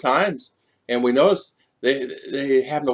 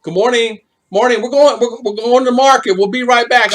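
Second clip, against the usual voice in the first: higher, louder cheery neighbour voice; imitating passing neighbours; 10 semitones higher, 5 dB louder than usual. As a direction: sing out the greeting like a neighbour heading to market.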